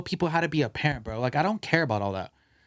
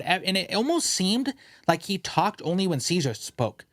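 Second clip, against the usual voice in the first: ordinary delivery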